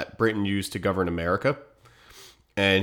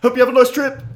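mock cheery farewell; 8 dB louder than usual. Quip to acid victim.

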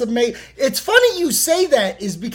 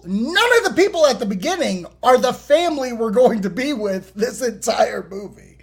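ordinary talk, nothing marked

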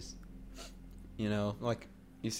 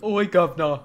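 loud, exaggerated British accent with cockney slang; mock British accent; 3 semitones higher, 4 dB louder than usual. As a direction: ham up a British bloke asking for tea. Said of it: "British people, right? They're like".

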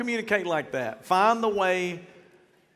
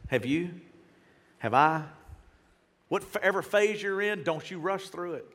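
usual speaking voice

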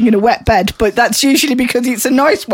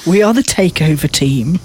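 usual speaking voice

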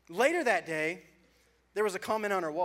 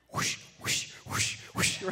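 whooshing fabric noise; slower than usual. Retold or imitated. Windbreaker suit noise.

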